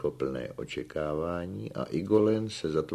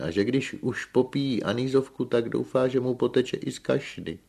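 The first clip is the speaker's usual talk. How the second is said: higher-pitched villager confessing; animated admission; 8 semitones higher, 4 dB louder than usual. A narrator's reading. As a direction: keep the confession lively and high. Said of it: "přiznal se Filoxen".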